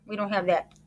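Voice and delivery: ordinary talk, nothing marked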